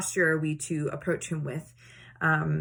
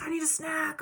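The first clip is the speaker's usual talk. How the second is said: put-on high whiny child voice; imitating her son begging for a snack; whiny kid; 10 semitones higher, 4 dB quieter than usual. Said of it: "or like".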